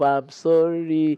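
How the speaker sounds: slow, drawn-out sorry; slow apology. Retold or imitated. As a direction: stretch the apology out gently. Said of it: "It's full of".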